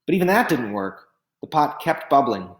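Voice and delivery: ordinary delivery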